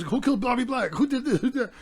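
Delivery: imitating interrogator. Retelling the movie to friends, mock-voicing the man grilling Zuzu.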